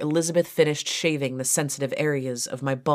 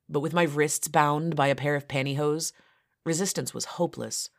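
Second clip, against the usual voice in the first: ordinary delivery, nothing marked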